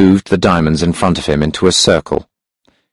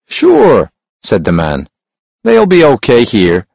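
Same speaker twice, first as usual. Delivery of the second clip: porter voice; 4 semitones higher than usual. A narrator's reading.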